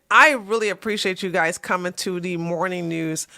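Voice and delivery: normal talking voice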